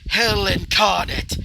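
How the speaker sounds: slow, loud, dark roar; roaring proclamation. Acted. Vlad roaring as darkness surrounds him. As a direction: roar it like a curse unleashed. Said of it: "as he would say".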